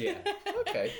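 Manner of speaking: laughing okay. Real, not acted. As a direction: say it through a laugh. Laughing after teasing joke.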